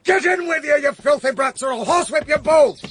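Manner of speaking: put-on shrill high scolding of the children, sharp and fast; angry scolding. Mistress scolds the children for staying out: high angry shout. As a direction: lash out at the filthy brats.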